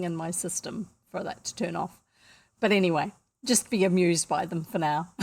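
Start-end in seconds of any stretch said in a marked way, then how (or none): none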